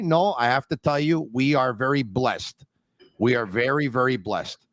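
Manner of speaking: normal talking voice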